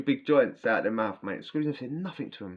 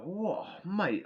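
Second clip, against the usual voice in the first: dazed and stoned; 9 semitones higher, 4 dB quieter than usual. Retold or imitated. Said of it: "I'm mullered".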